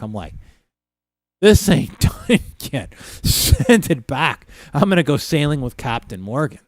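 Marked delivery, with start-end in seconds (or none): 1.4-6.6 loud, high, indignant send-it-back complaint; hammed-up barroom outburst, higher and louder; mock indignant rant. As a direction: complain loudly, playing up the outrage. Acted drunken complaint to bartender.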